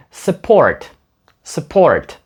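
"Support" as "s'port"; reduced SUPPORT. Shown as a demonstration. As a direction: barely voice the u in "Support". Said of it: "Ю майже не вимовляється".

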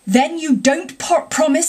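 high-pitched disbelieving question; pressing and affronted; indignant challenge. A narrator's reading. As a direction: demand an answer from a backslider. Badger challenges unrepentant Toad.

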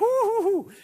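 high-pitched mock hooting in pain; hammed-up high "hoo hoo" yelps; imitated pained whooping. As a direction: whoop like a kid stung by a smack. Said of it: "collapse on the floor and he'll be like".

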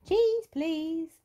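high, loud, keen request; high-voiced character asking; rabbit customer's order. A narrator's reading. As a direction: call out the order eagerly. Grampy Rabbit places his order.

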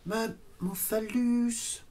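soft, very slow, lofty title; hushed, drawn-out pretentious musing; mock grandeur. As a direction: savour each word slowly. Tries grand title for his play.

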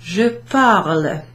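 slow, careful French je parle for students to copy; French model phrase. Shown as a demonstration. Teacher models je parle for the class, slow and careful. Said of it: "I speak or I am speaking".

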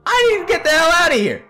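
high-pitched acted panic; desperate outburst. Trapped character begs to escape, desperate.

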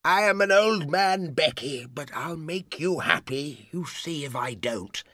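put-on desperate elderly suitor; begging. A narrator's reading. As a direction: implore her to accept. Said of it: "Sir Pitt begged her".

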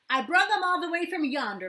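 put-on high, loud excited little boy; a proud child bragging. A narrator's reading. Matt to Kira and Thomas, loud excited child.

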